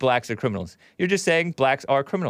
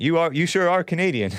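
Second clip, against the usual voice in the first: usual speaking voice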